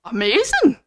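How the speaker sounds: loud, acted amazement; amazed Gruffalo. Gruffalo impressed by the mouse, loud amazement. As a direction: say it big, in mock wonder. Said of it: "what did he say?".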